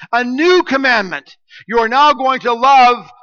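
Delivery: high, loud preaching stress on "new"; emphatic NEW. Shown as a demonstration. Pastor showing the Greek emphasis on "new", emphatic and loud. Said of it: "the word new is emphatic".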